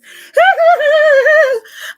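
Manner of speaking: loud, high-pitched laugh; high cackle. Real, not acted. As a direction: let out a long, high cackle. Cackles after "them ones.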